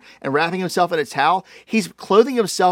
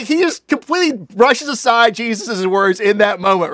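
normal talking voice

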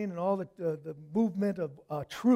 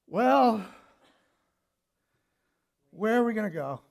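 resigned; 3 semitones higher, 6 dB louder than usual. Acted. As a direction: give in, with falling tune. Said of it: "It was almost like resignation".

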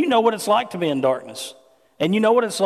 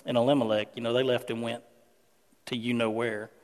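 low, hushed gossip voice, much softer; gossiping relative; 8 semitones lower, 7 dB quieter than usual. Retold or imitated. Naomi's family back home gossiping about her: low, hushed. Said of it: "she goes".

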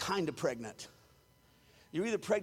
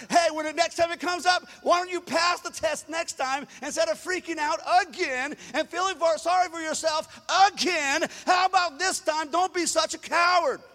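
loud, high, scolding preaching; preaching at full force; loud rebuke; 6 semitones higher, 5 dB louder than usual. Acted. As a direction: tear into them for self-pity. Rebuking the fearful.

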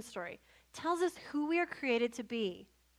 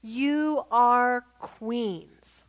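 very slow, loud, drawn-out pronouncement; grand slow proclamation; 7 dB louder, much slower than usual. Retold or imitated. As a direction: announce it like a coronation. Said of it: "she says".

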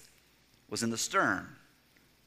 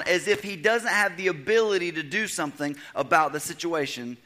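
rising preaching; 7 semitones higher than usual. Acted. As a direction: build the sermon to a fervent peak. Preacher builds to full force.

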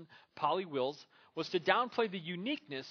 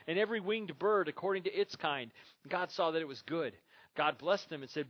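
louder, raised preacher's reading voice; Scripture reading; 3 semitones higher, 3 dB louder than usual. Acted. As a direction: read the verses out to the congregation. Reads Scripture aloud to congregation.

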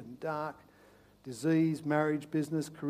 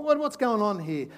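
normal talking voice

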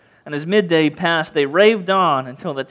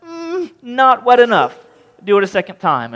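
mock-fussy Elijah; 5 semitones higher, 4 dB louder than usual. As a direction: tease them that it's still too dry. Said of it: "And he said".

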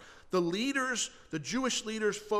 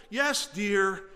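mock-compliant reply, pushed louder; wrong-toned agreement; 6 dB louder than usual. Spouse answering husband or wife, insincere compliance. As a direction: act out saying the right thing in the wrong tone. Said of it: "the right thing in the wrong tone".

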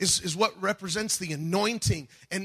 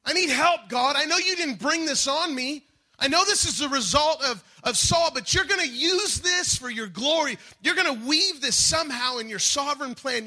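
raised, high-pitched plea to God; crying out; 6 semitones higher than usual. Acted. Preacher acts out David's prayer for help, urgent cry. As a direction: plead urgently, pitch lifted, pushing through each phrase. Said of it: "He's crying out to the Lord".

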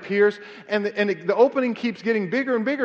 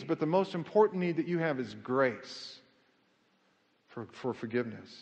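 drops much softer and lower on forgiveness; hushed emphasis; 9 semitones lower, 10 dB quieter than usual. Acted. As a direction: sink to a near hush for the key word. Climax of the sermon point.

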